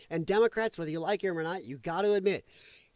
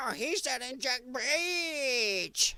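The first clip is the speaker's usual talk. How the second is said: mocking whiny critic; 6 semitones higher, 4 dB quieter, much slower than usual. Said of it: "Everybody's like".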